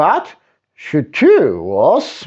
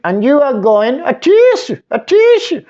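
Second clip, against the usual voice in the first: louder, high sneezing a tissue; mock sneeze; 12 semitones higher, 3 dB louder than usual. A narrator's reading. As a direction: build into a fake sneeze. Dizzy acts the sneeze.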